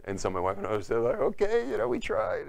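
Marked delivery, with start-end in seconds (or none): 1.2-2.5 high, louder mock-meek voice; sheepish retreat. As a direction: back off in a squeaky little voice. Rebuffed couple backing off, acted out.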